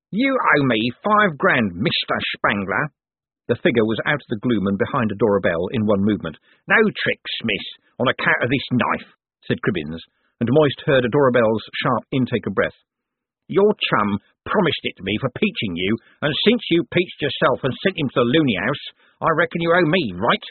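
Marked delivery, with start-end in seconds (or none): 0.1-2.9 slow, threatening thug voice; menacing crook. Acted. Cribbins' threatening voice. 6.7-20.4 threatening street thug voice; menacing crook. Acted. Cribbins holds a knife.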